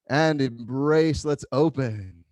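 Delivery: ordinary talk, nothing marked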